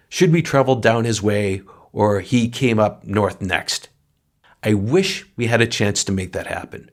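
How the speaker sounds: usual speaking voice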